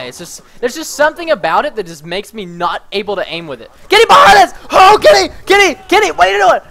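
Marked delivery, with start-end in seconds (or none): 3.9-6.1 high, loud urgent yelling; alarmed shout. Real, not acted. Warning Kenny of an enemy behind them, alarmed shout. 6.1-6.6 high, urgent call to a teammate; alarmed demand. Real, not acted. Mistaking someone else for Kenny, alarmed demand.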